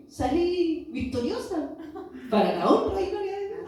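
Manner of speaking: joyful laugh to the congregation; laughing triumph. Real, not acted. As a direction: laugh out the victory. Triumphant ending of testimony.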